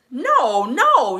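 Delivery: high, loud mock wail of dismay; imitated tearful denial. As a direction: act out a friend crying out in disbelief. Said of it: "Tisha starts crying, and she says".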